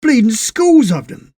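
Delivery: shouted, high and loud; loud alarmed cry. A narrator's reading. Les shocked at his hands, loud cry. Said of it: "he cried out".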